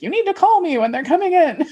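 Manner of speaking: high, fast mock bossy mom; laughing impression of a mother laying down the law; imitating demanding mom. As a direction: play the pushy mom insisting with a laugh. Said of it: "was like".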